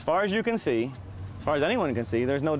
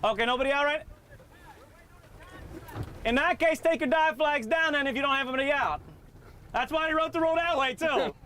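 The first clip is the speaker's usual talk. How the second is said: calling out; 12 semitones higher than usual. Real, not acted. Calling across to boaters.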